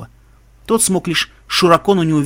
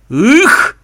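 startled grunt; 9 semitones higher, 9 dB louder than usual. Acted. Startled cry on landing.

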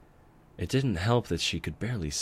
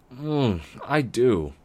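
higher, louder, savoring the treat; greedy young dragon; 7 semitones higher, 6 dB louder, much slower than usual. Acted. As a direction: mmm like he's tasting candy. Dragon licking his chops.

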